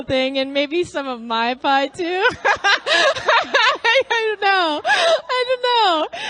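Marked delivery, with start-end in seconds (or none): 2.3-3.9 a real, high laugh bursting out; real amused laughter. Real, not acted. Laughing at teacher's stories. 3.9-6.2 higher, laughing through the words; can't stop laughing while speaking; real laughter, high and helpless; laughing helplessly. Real, not acted. Laughing at teacher's stories.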